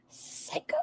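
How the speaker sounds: hushed, voiceless whisper; whispered verdict. Real, not acted. Reactor judging the cackle just mimicked, whispered. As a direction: breathe it out under your breath.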